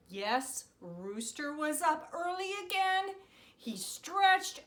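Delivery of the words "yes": ordinary delivery, nothing marked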